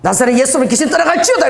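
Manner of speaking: loud, high rebuke of the demon; commanding exorcism. Retold or imitated. Preacher commanding a demon to leave, high and loud. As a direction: cast it out with authority.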